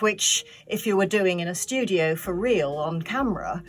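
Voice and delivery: ordinary talk, nothing marked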